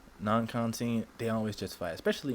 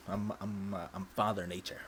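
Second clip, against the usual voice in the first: ordinary delivery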